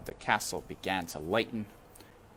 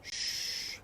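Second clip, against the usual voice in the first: breathless hush; unvoiced shush; 8 dB quieter than usual. A narrator's reading. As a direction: shush her softly. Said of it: "said Louise".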